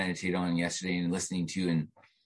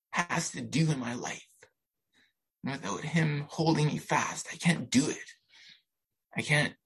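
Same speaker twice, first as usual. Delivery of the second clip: moved, voice pitched up with emotion; choked up; 3 semitones higher than usual. Real, not acted. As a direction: speak through welling tears, pausing often. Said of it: "I can't read it without crying".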